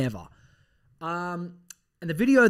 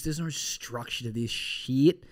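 usual speaking voice

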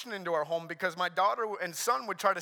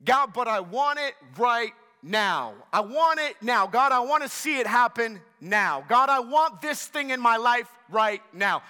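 higher, louder mock-insistent plea; acted pushy insistence; impatient demand; 4 semitones higher, 5 dB louder than usual. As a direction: demand it from God like an impatient child. Acting out impatient demands to God.

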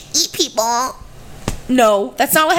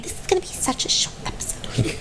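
softer, airy whispered aside; ironic whisper; 7 dB quieter than usual. Retold or imitated. Mocking themselves about the short episode, in a soft whisper. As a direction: whisper it like a joke callback. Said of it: "Cut to us saying".

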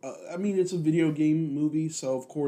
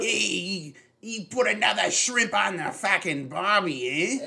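hammed-up Australian bloke, higher pitch; mock Kano in a thick Australian accent; 6 semitones higher, 3 dB louder than usual. Friend mocks trailer Kano, hammed-up Australian accent. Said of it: "they make Kano really fucking Australian".

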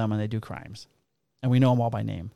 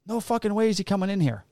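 high-pitched mock refusal; lightly voiced old father, pitched up; imitated indignant father; 8 semitones higher than usual. Old Greek father refusing to take his son, mock-indignant.

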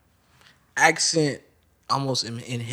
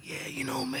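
[name]; 11 semitones higher, 15 dB quieter than usual. Acted. Storyteller quoting the jazz trumpeter to the others, whispered impression. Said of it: "talking about what Miles said".

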